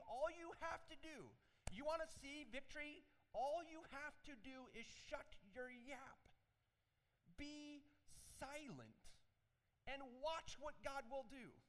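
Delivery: Moses commanding. Retold or imitated. Moses voiced before Red Sea.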